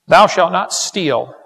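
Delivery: slow, loud, weighty pronouncement of the commandment; solemn proclamation. Acted. Preacher reads the commandment to the crowd, slow and loud. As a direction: boom it out slowly like scripture.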